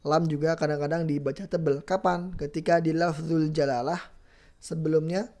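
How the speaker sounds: usual speaking voice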